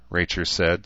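plain reading voice

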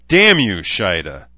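loud, angry snarl; snarled curse. A narrator's reading. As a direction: spit the curse out forcefully. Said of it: "rubbed her face and snarled".

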